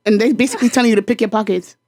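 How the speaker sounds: ordinary delivery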